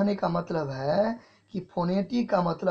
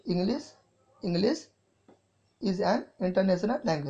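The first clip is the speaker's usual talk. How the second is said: slow dictation. Shown as a demonstration. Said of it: "देखिए, तीन चीज़ें होती है।".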